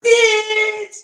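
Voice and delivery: mock sob. Mock crying after reading.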